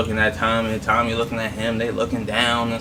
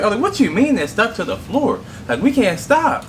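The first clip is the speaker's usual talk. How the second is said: shocked; 11 semitones higher than usual. Retold or imitated. Shocked by stuck pedal.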